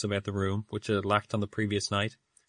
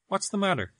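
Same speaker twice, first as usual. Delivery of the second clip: sudden alarm; 10 semitones higher than usual. A narrator's reading. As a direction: voice jumps up in alarm.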